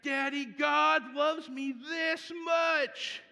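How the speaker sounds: high, loud and slow shout; joyful child shout. Retold or imitated. Son telling dad God protected him, shouting for joy. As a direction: belt it out like a delighted toddler.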